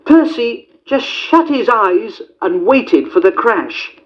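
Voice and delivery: ordinary delivery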